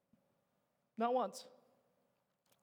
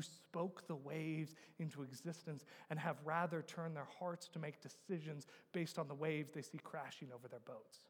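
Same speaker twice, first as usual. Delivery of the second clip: low, soft pulpit voice; hushed, solemn preaching; 4 semitones lower, 9 dB quieter than usual. Real, not acted. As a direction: bring the room down to a grave quiet. Preacher drops to solemn hush.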